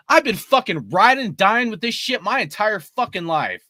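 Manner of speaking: usual speaking voice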